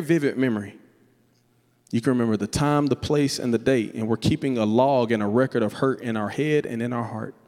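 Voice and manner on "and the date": usual speaking voice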